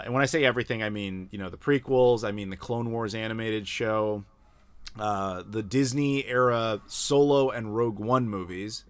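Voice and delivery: ordinary talk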